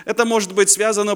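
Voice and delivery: usual speaking voice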